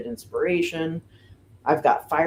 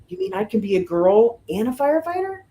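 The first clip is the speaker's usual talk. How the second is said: higher, wide-eyed wonder; a child's delighted discovery, pitched up; amazed little girl; 7 semitones higher than usual. Retold or imitated. Little girl realizing she can.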